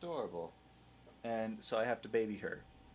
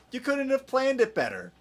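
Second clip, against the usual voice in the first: amazed; 14 semitones higher, 6 dB louder than usual. Real, not acted. Marvelling at a clip.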